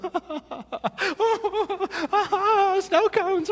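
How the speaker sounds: put-on high, loud, slow wailing kid suddenly spotting snow cones; crying child. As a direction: imitate a sobbing child whose tears turn to delight. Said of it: "and then they go".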